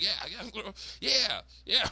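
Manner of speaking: high, eager yeah-saying; put-on girl voice. Daughter confirms tattoos to mom, high and insistent.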